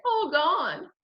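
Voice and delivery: put-on high, small child's voice; little toddler. A narrator's reading. Little brother telling his mother, childlike.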